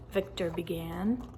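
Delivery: usual speaking voice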